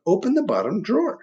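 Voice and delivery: the dark instructing. Acted. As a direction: tell the boy what to do. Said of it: "said the dark".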